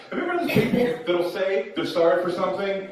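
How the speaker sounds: ordinary talk, nothing marked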